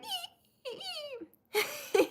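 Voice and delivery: very high, thin mewling cries; whiny little kitten cry, imitated. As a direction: mimic a crying newborn kitten. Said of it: "He was crying behind me".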